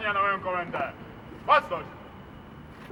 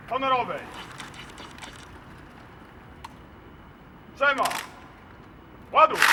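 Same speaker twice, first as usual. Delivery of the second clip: shouted salute orders; high ringing parade commands; military command; 9 semitones higher than usual. Real, not acted. (wykrzykiwane komendy salwy)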